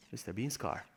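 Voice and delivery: low, soft aside to yourself; quiet repeat. Real, not acted. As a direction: drop it down to a murmur. Quiet repeat after the excitement.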